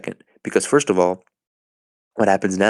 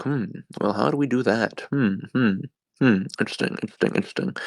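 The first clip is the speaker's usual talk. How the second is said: mock pondering; 3 semitones higher, 6 dB quieter, faster than usual.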